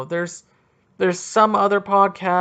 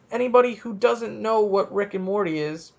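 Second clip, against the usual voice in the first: ordinary delivery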